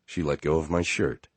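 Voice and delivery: ordinary delivery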